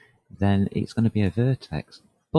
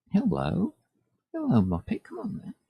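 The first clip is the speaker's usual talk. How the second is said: high sing-song pet talk, much higher; cooing to a pet; 11 semitones higher than usual. Real, not acted. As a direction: coo at the cat lovingly. Calling the cat Muppet over.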